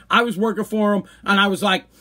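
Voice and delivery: ordinary delivery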